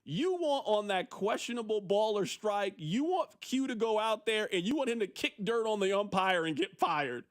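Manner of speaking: ordinary talk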